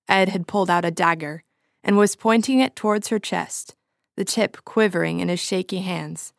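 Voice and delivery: ordinary delivery, nothing marked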